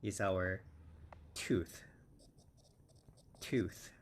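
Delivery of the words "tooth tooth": tooth with a long U and a clear th; tooth drill. Shown as a demonstration. Teacher models tooth for students with a long U. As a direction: stretch the long U in tooth, end on th. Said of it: "T and U, long U, and th".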